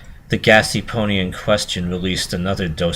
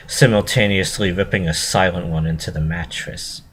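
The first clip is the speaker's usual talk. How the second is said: ordinary delivery, nothing marked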